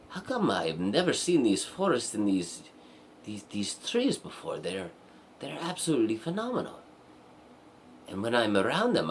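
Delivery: acted awe, pitch jumping up; enchanted princess. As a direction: gush over a first sight of nature. Princess marvels at the woods.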